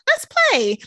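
mock sudden cheerful kid invitation; excited child. Mom imitating kids making up, excited child.

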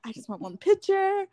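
high mock-desperate plea; pleading. Cheerleader wishing for a photo with singer, pleading. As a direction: beg like a starstruck fan, pitch up.

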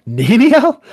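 very high, loud theatrical shriek; over-the-top high-pitched outcry; incredulous cry. Acted. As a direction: escalate into a piercing, hammed-up question. Same name repeated, far more intensely.

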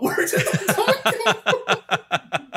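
slow, high, laughing bewilderment; baffled disbelief. Retold or imitated. Viewer reenacting their own confusion, laughing disbelief. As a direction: ask it drawn out, cracking up. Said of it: "I was literally sitting there going like".

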